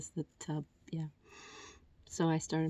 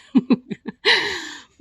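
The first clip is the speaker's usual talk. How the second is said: high burst of laughter; amused laugh; 12 semitones higher, 8 dB louder than usual. Real, not acted. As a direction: laugh at your own late realisation. Laughs at own realisation.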